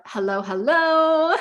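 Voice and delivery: drawn-out cheerful welcome, slow; slow, sing-song intro greeting. Retold or imitated. Host imitating her guest's live-stream hello, drawn out slow. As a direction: stretch out a high bright hello, hello. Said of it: "I do love, especially when".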